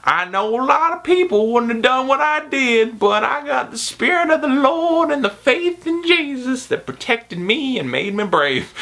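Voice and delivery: hammed-up humble-brag about Jesus; mock churchy testimony; pious old lady. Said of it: "He's like".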